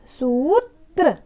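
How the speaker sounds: सूत्र drawn out slowly, syllable by syllable; सूत्र pronounced slowly with a clear tr cluster; slow model reading. Shown as a demonstration. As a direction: stretch out सूत्र for the class to repeat. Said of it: "बोलिए साथ में".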